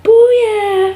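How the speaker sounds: high, stretched celebratory whoop; party cheer. Retold or imitated. Relatives throwing a party over her major, high celebratory cheer. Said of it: "start throwing this party and they're like".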